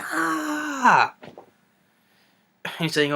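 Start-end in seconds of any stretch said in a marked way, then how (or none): 0.0-1.1 long, very high imitation scream, held back in volume; mock scream. Reviewer mocks the heroine still screaming while loading a gun, mock scream. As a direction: mimic a relentless shriek. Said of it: "she's still screaming".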